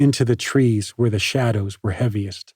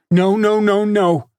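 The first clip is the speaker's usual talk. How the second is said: much higher and louder repeated no; screeching; 10 semitones higher, 5 dB louder than usual. A narrator's reading. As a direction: shriek it right in his face. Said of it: "she screeched in his face".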